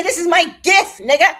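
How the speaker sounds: loud, high insistence on her gift; loud retort. Retold or imitated. Telling her grandson off, loud and high-pitched. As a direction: plant your feet and claim it loudly. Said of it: "I said".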